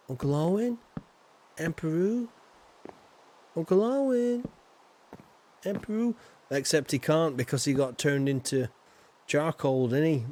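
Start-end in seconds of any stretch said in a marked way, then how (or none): 0.1-6.1 playful calling out. Pretend.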